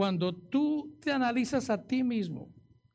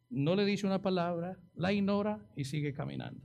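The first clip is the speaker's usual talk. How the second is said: normal talking voice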